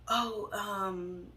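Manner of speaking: imitating a stalled, drawn-out "oh, um"; caught-out hesitation. Boyfriend caught with girl on his lap, imitated hesitation. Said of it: "just like that".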